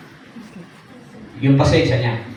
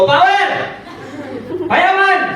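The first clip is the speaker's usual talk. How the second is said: crowd shouting; 10 semitones higher, much slower than usual. Retold or imitated. Seminar crowd shouting to hype everyone up. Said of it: "ang dami nagsisigaw".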